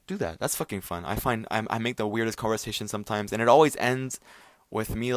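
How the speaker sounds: normal talking voice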